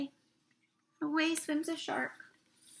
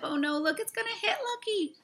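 mock alarm; 10 semitones higher than usual. Ship about to hit Lucky.

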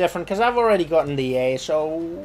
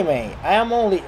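ordinary talk, nothing marked

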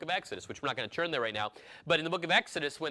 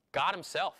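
usual speaking voice

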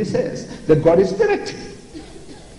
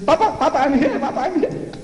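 put-on much higher giggly child voice; excited child; 11 semitones higher than usual. Grandchild to grandfather, a much higher giggly child voice. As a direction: squeal like a small child found. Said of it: "She says".

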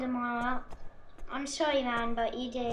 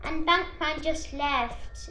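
ordinary talk

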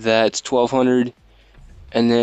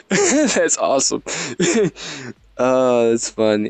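amused laughing. Real, not acted. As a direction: say it through real laughter. Laughs at the game's dialogue.

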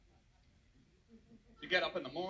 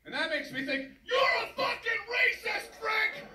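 mock furious shout; 6 semitones higher, 4 dB louder than usual. [name] on phone to Frank, building into hammed-up screaming.

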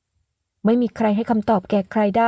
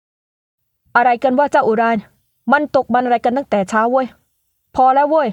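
louder father, rising to a shout; gruff father shouting; 3 semitones higher, 4 dB louder, slower than usual. Acted. Father shouting at his son to stop, louder. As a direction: break it up.

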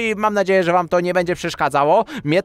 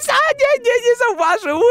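relieved; 12 semitones higher than usual. Real, not acted. Relief friend didn't notice.

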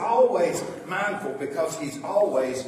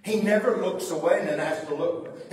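normal talking voice

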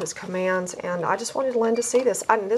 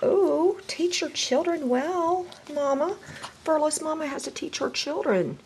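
sing-song self-talk; 9 semitones higher than usual. Acted. Dog breeder to herself, high sing-song. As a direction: lilt it up like a little tune.

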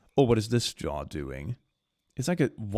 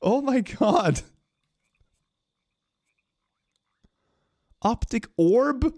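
stunned disbelief; 13 semitones higher, 5 dB louder, much slower than usual. Real, not acted. Reacting to weird eye options.

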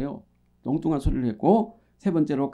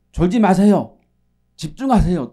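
louder mock scolding of dozers; raised-voice call to attention; 7 dB louder than usual. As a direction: call out as if waking a drowsy congregation. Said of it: "집중시키려고 목소리에 톤을 올린다든지". (조는 성도를 깨우듯 목소리를 높여서)

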